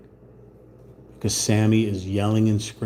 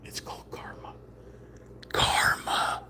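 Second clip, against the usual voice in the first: whispered aside; 10 dB quieter than usual. Pretend. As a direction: whisper it like a mystic secret. Mock-mystical aside closing the rant.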